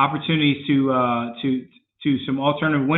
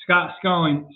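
ordinary talk

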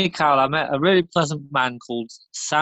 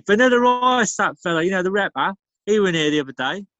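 ordinary talk, nothing marked

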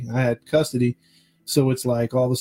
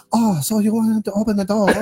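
higher, louder mock Korean accent; 8 semitones higher, 4 dB louder than usual. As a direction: tease him in a put-on Korean accent. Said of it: "when I heard his accent".

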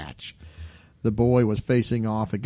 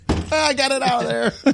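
high-pitched, playful escape cry; mock triumph; 11 semitones higher than usual. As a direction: squeal it like a narrow escape. Acting chess player snatching hand back.

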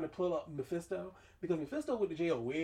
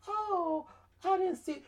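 much higher, whiny mock excuse; mocking Doctor Strange; 14 semitones higher, 4 dB louder, slower than usual. Fan mocking Doctor Strange's excuse, high whiny voice. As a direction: squeak it like a caught-out kid. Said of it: "then you gonna tell us later".

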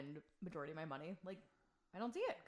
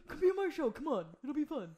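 high, loud mock begging; mocking pleading; 8 semitones higher, 8 dB louder than usual. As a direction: ham up his pleading voice. Mimics host begging her.

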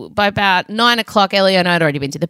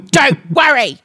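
sarcastic dismissal; 7 semitones higher, 3 dB louder, slower than usual. Retold or imitated. Sarcastic dismissal of daughter's offer.

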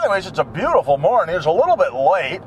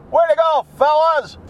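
cheery shout-out; 10 semitones higher than usual. Real, not acted. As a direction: cheer the road crew. Cheering the workers.

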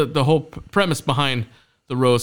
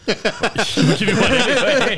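laughing; 8 semitones higher, slower than usual. Real, not acted. Laughing while teasing friend.

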